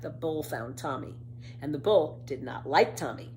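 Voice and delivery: usual speaking voice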